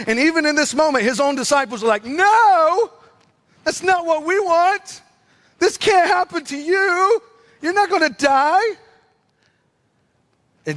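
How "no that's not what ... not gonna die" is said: high, pleading protest. Retold or imitated. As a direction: refuse to accept it, drawing the words out. Acting out disciples protesting to Jesus.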